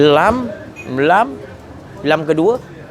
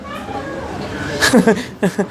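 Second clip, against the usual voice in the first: high laugh; 4 dB quieter than usual. Real, not acted. Laughing mid-sermon.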